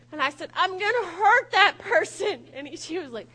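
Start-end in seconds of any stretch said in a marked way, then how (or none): 0.5-2.5 loud, high, slowed-down threat; exasperated road-rage outburst; angry threat. Retold or imitated. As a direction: shout it word by word in outrage. Acted anger at other driver.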